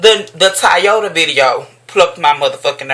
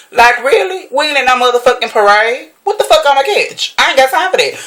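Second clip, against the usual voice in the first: higher, incredulous outburst; exasperated rant; 7 semitones higher than usual. Real, not acted. Vlogger ranting at Facebook posters, higher and exasperated. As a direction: throw up your hands in disbelief.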